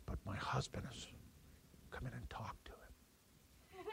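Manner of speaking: secretive hushed whisper, breath only. Retold or imitated. Wife whispers to visitors about her unsaved husband. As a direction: whisper it so the husband won't overhear.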